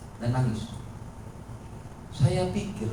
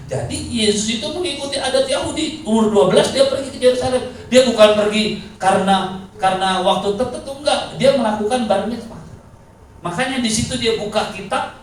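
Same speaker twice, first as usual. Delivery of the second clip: lightly raised preaching voice; 8 semitones higher, 5 dB louder than usual. Acted. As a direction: press the point home to the church. Preaching at full force.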